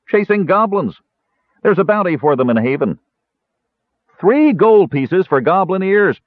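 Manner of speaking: high, boastful ranger's voice; hearty tavern ranger. A narrator's reading. Ranger bragging about goblin hunting, hearty. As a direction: sing out the bounty with relish.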